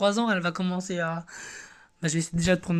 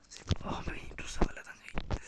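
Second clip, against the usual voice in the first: whisper; 12 dB quieter than usual. Real, not acted. Awed aside at his account.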